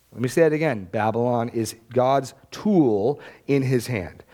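normal talking voice

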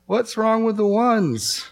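lightly voiced high, slow puzzled question; clueless reply. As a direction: play the innocent who sees no problem. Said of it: "look at them and say".

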